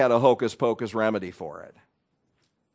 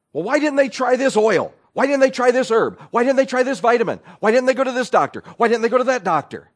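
high, loud, pushy questioning; a busybody grilling the widow; nosy know-it-all; 9 semitones higher, 7 dB louder than usual. Retold or imitated. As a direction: badger the grieving one with questions. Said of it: "The unbelievable goes something like this".

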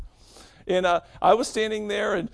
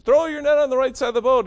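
loud, high call across the water; yelled call; 6 semitones higher, 6 dB louder than usual. Retold or imitated. Jesus calling to fishermen on the lake, loud yell. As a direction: shout it out to the boat from shore. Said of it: "So Jesus yells out to them".

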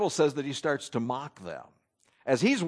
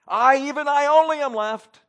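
high, loud despairing cry; discouraged lament; 8 semitones higher, 6 dB louder than usual. Retold or imitated. Preacher voicing a lonely prophet, discouraged. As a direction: voice sinking in despair. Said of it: "the discouragement creeping out of his voice".

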